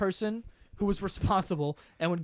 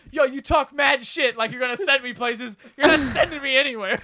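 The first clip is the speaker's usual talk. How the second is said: raised, loud mock defiance; defiant back-talk; 5 semitones higher, 5 dB louder than usual. Sean refusing the teacher's threat, mock angry shouting. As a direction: argue back forcefully, imitating a pupil.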